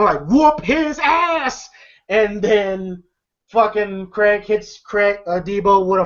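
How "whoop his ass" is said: high, slow, drawn-out mock cheer; imitated cheering. Smokey cheering on Craig, hammed-up cheer. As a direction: stretch it out like a ringside supporter. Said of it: "the fucking Smokey's cheering him".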